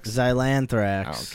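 slow, drawn-out reading. Shown as a demonstration. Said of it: "announcer reads the word".

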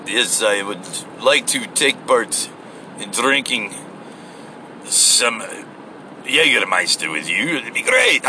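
badly faked Russian accent, imitating a film actor; horrible Russian accent. Imitating Travolta's movie character ordering drinks, in a horrible Russian accent. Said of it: "he does his horrible Russian accent".

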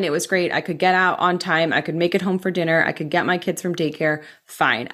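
usual speaking voice

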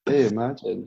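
ordinary delivery

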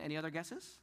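normal talking voice

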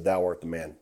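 low, quiet and solemn; grave accusation. Acted. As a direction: deliver the verdict softly and gravely. Nathan accuses David.

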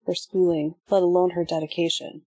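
usual speaking voice

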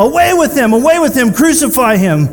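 loud, high-pitched mob cry; yelling. Acted. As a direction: shout it down like a crowd demanding death.

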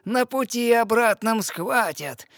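the witch warning her slyly; sea witch. Acted. Witch gives advice on polyps.

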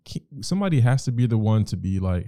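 usual speaking voice

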